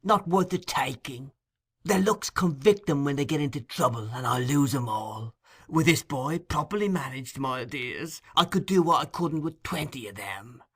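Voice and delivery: put-on high, coaxing old villain. A narrator's reading. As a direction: persuade Sikes with oily charm.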